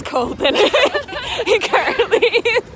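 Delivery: laughing through the words. Real, not acted. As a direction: giggle while saying it. Laughing outdoors in the cold.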